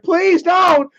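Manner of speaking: imitating a high, loud sobbing plea; hammed-up crying begging; mock crying plea. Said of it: "started crying and he was like".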